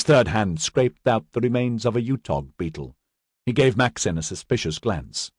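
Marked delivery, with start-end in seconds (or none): none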